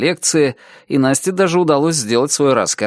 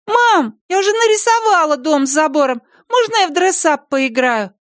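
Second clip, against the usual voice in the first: put-on very high, ringing child voice; little girl calling. A narrator's reading. Daughter calls to mom from next room, high child's call. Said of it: "раздался звонкий крик девочки".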